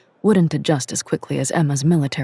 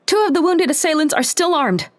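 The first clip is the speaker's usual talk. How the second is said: high, loud warning shout; yelling; 12 semitones higher, 3 dB louder than usual. A narrator's reading. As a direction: call it out urgently. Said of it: "she yelled".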